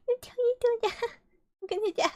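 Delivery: high, breaking through laughter; can barely speak for laughing; real giggling fit. Real, not acted. Reader laughing uncontrollably.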